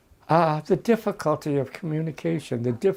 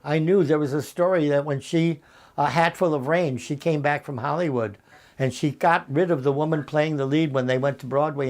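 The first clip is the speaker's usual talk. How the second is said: usual speaking voice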